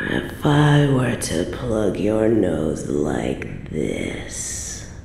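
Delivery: very low, hushed menace; sinister gloating. Acted. Queen toying with gagged captive, softly menacing. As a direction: sink into a low, savoring purr.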